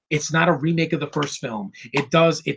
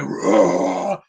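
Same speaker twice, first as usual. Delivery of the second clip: mock roar; 8 semitones lower than usual. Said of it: "when he's like".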